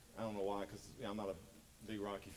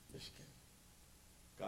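quiet yes you can; 10 dB quieter, much slower than usual. Shown as a demonstration.

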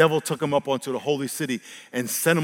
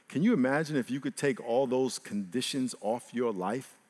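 normal talking voice

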